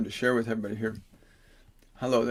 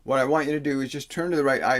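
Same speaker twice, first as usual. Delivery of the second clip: normal talking voice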